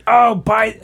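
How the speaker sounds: mock shout at the bar; mild mock yell to the bartender; retold yell. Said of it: "he's yelling at the bartender".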